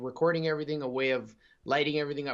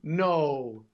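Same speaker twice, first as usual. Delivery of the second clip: ordinary delivery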